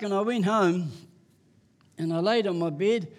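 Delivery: ordinary talk, nothing marked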